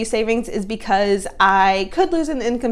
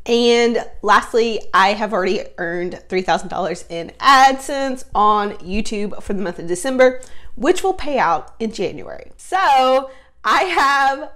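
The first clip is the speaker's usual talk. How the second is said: ordinary delivery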